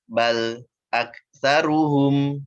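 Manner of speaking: hal fi dzalika with a break after the lam; wrong: voice stops on the lam; stopped lam. Shown as a demonstration. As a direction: cut the sound off at the l of hal, then go on. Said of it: "Nah, itu berhenti tuh".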